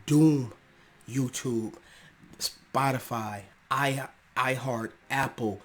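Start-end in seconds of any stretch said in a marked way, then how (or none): 0.1-0.5 louder, punching doomed; doomed cry. Real, not acted. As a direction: call it out like a sentence passed. Repeats doom line louder.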